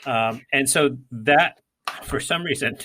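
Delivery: ordinary talk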